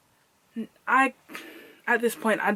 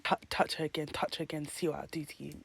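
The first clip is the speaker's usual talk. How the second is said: low menacing threat; 6 semitones lower, 12 dB quieter, faster than usual. Retold or imitated. Threatening the man.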